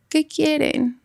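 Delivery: soft, drowsy little voice; like someone half asleep answering; sleepy small voice. Retold or imitated. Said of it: "Como si estuviera dormida, como chiquito". (voz bajita de dormida)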